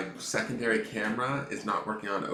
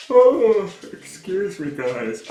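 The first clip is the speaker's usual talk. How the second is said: huge real yawn, much higher and louder, then an apology; big yawn; 11 semitones higher, 13 dB louder, much slower than usual. Real, not acted. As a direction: let the yawn burst out before saying sorry.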